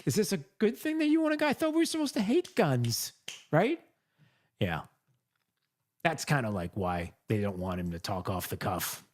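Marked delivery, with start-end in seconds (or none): 0.0-3.8 high, puzzled voice; mock confused crowd. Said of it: "the crowd is like".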